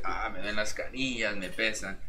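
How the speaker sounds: grandma's moan. Retold or imitated. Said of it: "mi abuelita que dice".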